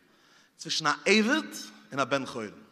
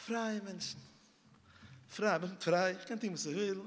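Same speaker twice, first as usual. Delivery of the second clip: hushed; 9 dB quieter than usual. Acted. Soft hushed declaration.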